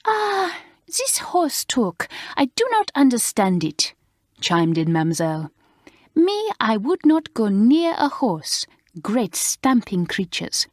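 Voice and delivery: a horrified French lady waving horses away; French governess. Acted. Mademoiselle refusing to go near a horse, in a fussy French accent.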